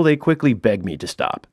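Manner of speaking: usual speaking voice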